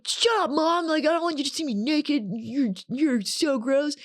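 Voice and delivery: high, whiny mock-teen snap; bratty teen. Snapping at mom about seeing her naked, high bratty teen.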